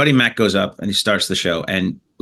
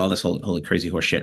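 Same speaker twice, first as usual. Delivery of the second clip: ordinary delivery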